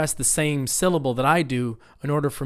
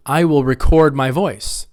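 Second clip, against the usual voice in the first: record as ri-CORD, said firmly; louder model line, record stressed at the end; second-syllable stress; 5 dB louder than usual. Shown as a demonstration. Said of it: "it's an action to record".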